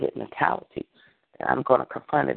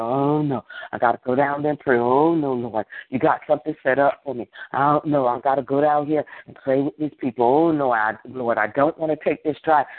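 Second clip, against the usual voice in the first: mock whining. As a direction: imitate someone dreading a chore. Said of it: "I'm not leaving here today saying".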